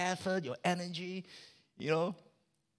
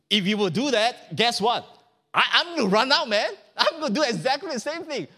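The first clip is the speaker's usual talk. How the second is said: higher and louder, defiant; defiant threat; 7 semitones higher, 5 dB louder than usual. Acted. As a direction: threaten to walk out. Son threatens father.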